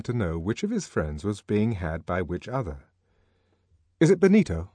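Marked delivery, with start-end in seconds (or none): 4.0-4.7 high, loud prying question; voiced Henry, pitched up and louder. A narrator's reading. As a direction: probe for gossip, pitched up. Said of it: "he questioned".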